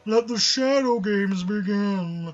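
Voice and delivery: slow, loud epic declaration; dramatic announcer. Pretend. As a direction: boom it like a villain. Dramatic quoted line.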